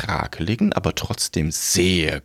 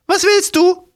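put-on high, loud old crone voice; shrill old woman; 7 dB louder than usual. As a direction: squawk it like a cranky old woman.

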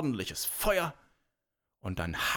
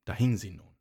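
usual speaking voice

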